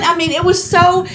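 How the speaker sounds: ordinary delivery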